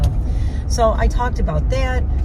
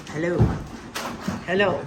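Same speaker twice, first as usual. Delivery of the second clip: normal talking voice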